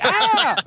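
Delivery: high, sudden startled scream; mock child shriek; kid's startled shriek. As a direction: shriek like the kid surprised at the door. Kid shrieks on door opening.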